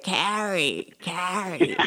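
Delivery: put-on name drawn out in a light New Jersey accent, Carrie with a dropped K; Jeff Lubin's New Jersey accent. Host imitating her teacher saying her name in a New Jersey accent. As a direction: mimic a New Jersey boss mangling Carrie as "arry". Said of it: "with your, like, New Jersey-esque accent".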